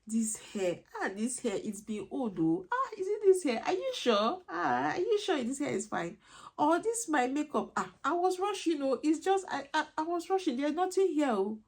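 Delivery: high, fluttery dismissal of a compliment; embarrassed parent waving off a compliment, pitched high; flustered brush-off. Retold or imitated. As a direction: squirm away from the praise, voice jumping up. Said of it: "I'm like".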